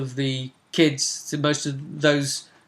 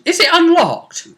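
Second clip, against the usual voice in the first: mock naive player; 6 dB louder than usual. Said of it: "quite a simple question".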